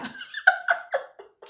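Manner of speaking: real breathy laugh; burst of laughter. Real, not acted. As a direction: laugh out loud at the memory. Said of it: "Aku tuh ketawa".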